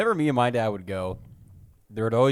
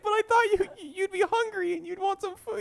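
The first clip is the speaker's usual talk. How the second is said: laughing quote. Real, not acted. Girlfriend voiced, teller laughing.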